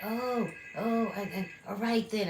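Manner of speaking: high, mocking huffy voice; imitating her huffy outburst; mock blowup. Retelling the roommate's blowup in a mocking voice.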